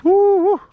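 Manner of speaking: high, loud "woo, woo"; excited whoop. Real, not acted. Hunter cheering the good wind, excited whoop. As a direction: let out a high-pitched whoop. Excited about the hunting weather.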